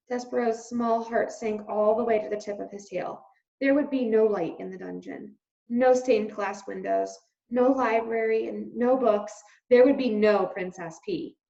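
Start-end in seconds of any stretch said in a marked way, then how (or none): none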